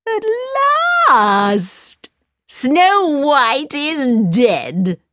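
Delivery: slow, high, gloating queen; evil queen. Acted. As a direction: crow over the victory slowly. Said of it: "she cried".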